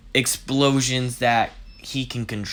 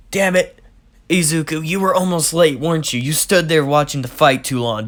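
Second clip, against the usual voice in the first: higher, louder scolding; scolding Bakugo; 5 semitones higher, 5 dB louder than usual. Acted. Bakugo scolds Izuku for watching the fight too long, loud and annoyed. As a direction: tell him off for nearly being late.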